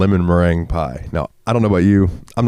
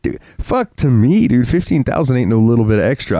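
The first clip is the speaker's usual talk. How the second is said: indignant outburst; 4 semitones higher than usual. Real, not acted. Scoffing at his co-host's little bit of extra. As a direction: push back hard, disbelieving.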